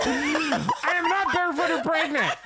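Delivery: high, loud protest through laughter; mock-indignant retort. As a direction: protest in a laughing, hammed-up squeal. Joking retort to acted request.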